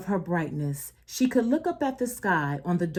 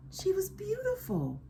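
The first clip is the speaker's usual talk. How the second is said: high and hushed; gently awed at her beauty; soft high admiration; 9 semitones higher, 8 dB quieter than usual. Real, not acted. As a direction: breathe it out tenderly. Reader savours moment.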